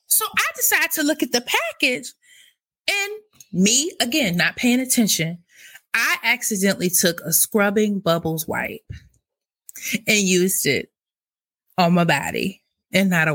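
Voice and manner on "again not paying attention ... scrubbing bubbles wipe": ordinary talk, nothing marked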